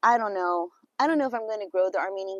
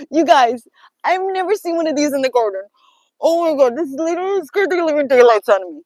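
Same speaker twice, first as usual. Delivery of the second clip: loud, high, shaken telling of a scare; frightened, excited; 3 semitones higher, 11 dB louder than usual. Real, not acted. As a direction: still rattled, louder and higher. Tells viewers about the scary find.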